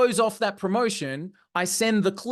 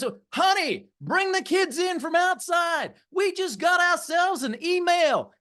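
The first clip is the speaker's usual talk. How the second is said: hammed-up Southern accent, pitched way up; Frank Kern, Southern accent; 9 semitones higher than usual. Speaker mocks the idea of everyone gathering for one email, exaggerated Southern accent. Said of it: "put his Southern accent on ... best Frank Kern impression".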